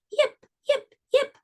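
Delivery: very high, squeaky fox yips; high little fox calling into the night. Acted. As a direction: yelp like a tiny fox calling out. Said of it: "She perked up her ears and called".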